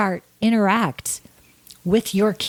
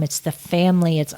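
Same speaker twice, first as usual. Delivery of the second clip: ordinary talk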